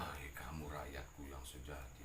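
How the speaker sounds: low, soft whispered awe. Acted. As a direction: breathe it out, moved. King moved, speaks to Abu Nawas.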